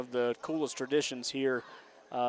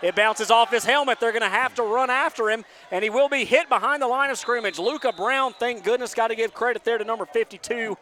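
excited; 7 semitones higher, 4 dB louder than usual. Real, not acted. Punt bounces off helmet, chaos.

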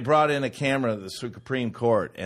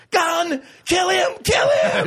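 very high, loud frantic yells; mock panicked yelling; 6 dB louder, slower than usual. President ordering guards to shoot, high panicked yells. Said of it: "I'd be like".

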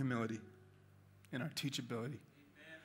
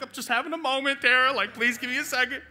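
high, strained emotional voice; 10 semitones higher, 9 dB louder than usual. Retold or imitated. Pastor reliving his breakdown in front of Tara, high and strained. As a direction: pitch climbing way up, voice breaking. Retold reply while crying.

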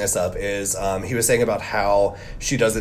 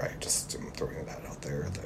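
whispered throwaway aside; half-voiced whisper, just for us; 8 semitones lower, 17 dB quieter than usual. Real, not acted.

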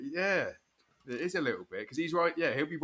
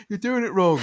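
mocking whiner; 10 semitones higher, 5 dB louder than usual. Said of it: "People, they're do".

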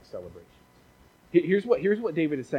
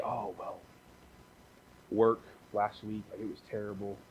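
mopey church member grumbling about work; gloomy moaning; 5 semitones lower, 7 dB quieter than usual. Retold or imitated. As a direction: sigh through it like nothing ever goes right. Gloomy friend answers.

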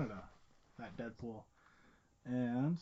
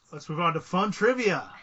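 mock hype; 4 semitones higher, 8 dB louder than usual. Announcing segment with mock glee.

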